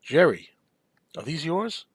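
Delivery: mild De Niro mimicry, the name called out and the question lifted; imitating De Niro. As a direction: quote the gangster's question to his hostage, kept close to the speaker's own voice. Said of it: "and he goes".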